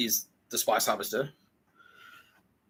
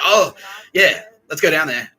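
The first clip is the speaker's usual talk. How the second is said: mock eager; 6 semitones higher, 10 dB louder than usual. Eager festival voice.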